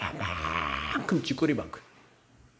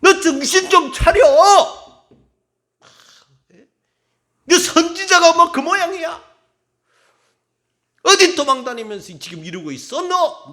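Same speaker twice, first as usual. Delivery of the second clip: loud, high, harsh berating of a sleeping prophet; scolding yell; 10 semitones higher, 11 dB louder than usual. Acted. Preacher voicing someone scolding the exhausted Elijah, angry yell. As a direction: bark at him like a furious scold.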